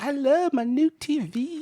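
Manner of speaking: high, slow, doting imitation; delighted granny. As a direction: draw it out as a smitten grandmother. Retells granny's repeated delight.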